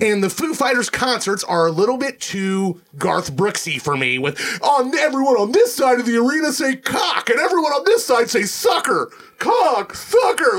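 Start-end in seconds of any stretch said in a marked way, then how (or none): 4.6-10.6 higher, yelling rock-star crowd hype; mock arena frontman. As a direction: whip up the arena like a hammy frontman. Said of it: "That's what it's like".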